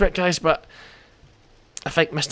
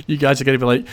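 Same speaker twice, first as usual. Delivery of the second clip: ordinary delivery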